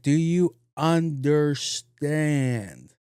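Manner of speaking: a stern slow-motion check; slow deliberate question. Retold or imitated. Security guard to speaker, slow and deliberate. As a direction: drag each word out slowly at the guard. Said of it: "He was like".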